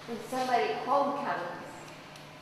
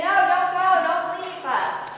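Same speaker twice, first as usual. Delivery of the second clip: high, loud plea that slows on don't leave us; pleading cry; 7 semitones higher, 7 dB louder than usual. Acted. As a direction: beg him not to go. Disciples beg Jesus to stay.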